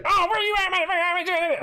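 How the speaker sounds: high-pitched screechy imitation of a ranter; mock ranting yell. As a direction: mimic his yelling, way up high. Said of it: "ranting, raves, and yelling like".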